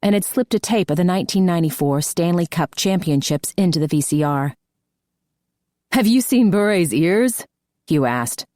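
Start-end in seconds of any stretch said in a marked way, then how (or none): 5.9-7.4 teasing man. A narrator's reading. Hugh teasing about ears.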